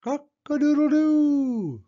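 very high, drawn-out crow; rooster crow. Acted. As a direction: crow like a rooster, long last note. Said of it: "crowed the rooster".

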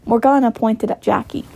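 plain reading voice

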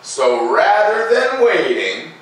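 slow, lilting read-aloud voice; gentle storyteller pacing, each phrase drawn out; story narration. A narrator's reading. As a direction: read it like a bedtime story for kids. Reading the picture book aloud.

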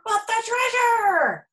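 high, loud, slow holler; captain hollering in alarm. A narrator's reading. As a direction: wail for the treasure. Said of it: "hollered Braidbeard".

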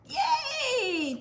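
high excited yay; cheer. Pretend. Reader cheering with children as tortoise crosses. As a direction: celebrate with the kids.

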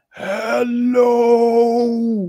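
high, loud dopey ape voice; a ridiculous ape saying its first word. Retold or imitated. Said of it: "he goes".

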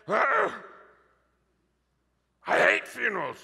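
hammed-up, high-pitched grumble of dread; groan, then a high mock complaint; mock disgust. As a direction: play someone who can't bear the subject. Mimics people who avoid death talk.

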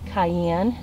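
ordinary talk, nothing marked

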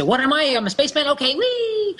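put-on playful child voice, high and bouncy; gleeful little kid squeal; excited kid. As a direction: play a kid jumping into pretend. Kid playing spaceman, quoted.

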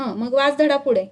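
ordinary delivery, nothing marked

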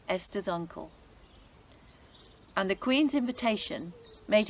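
lively, expressive storybook reading; story narration for children. A narrator's reading. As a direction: read it like a bedtime Bible story for kids. Reads Bible story to children.

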